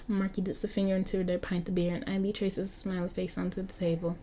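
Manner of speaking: ordinary talk, nothing marked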